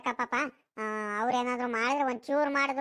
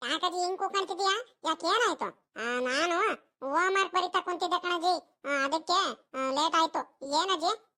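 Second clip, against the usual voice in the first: put-on high-pitched young grandson voice; high child character voice; 6 semitones higher than usual. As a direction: play a boy answering his grandmother, pitched up. Plays the grandson answering grandmother.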